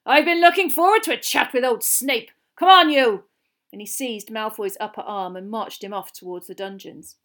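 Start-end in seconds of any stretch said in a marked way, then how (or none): 0.0-3.2 loud, higher, relishing threat; forceful old teacher. Acted. Teacher marching a boy off to Snape, loud and forceful. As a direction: boom it out, then snap an order. Moody orders Malfoy off to Snape.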